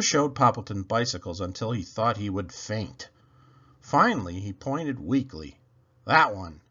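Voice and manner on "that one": croaked. A narrator's reading. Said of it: "he croaked".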